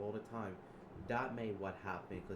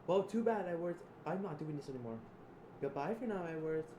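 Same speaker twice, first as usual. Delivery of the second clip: much higher and louder farewell; shrill final shout; 9 semitones higher, 6 dB louder than usual. Acted. Shouting a last goodbye to Edward before firing, high and loud. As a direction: scream the goodbye.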